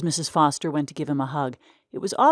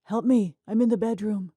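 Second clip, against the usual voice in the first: weak voice; 6 semitones higher than usual. Acted. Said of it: "a weak voice responding".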